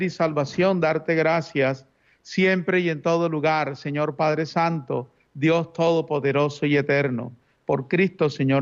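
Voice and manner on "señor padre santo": ordinary talk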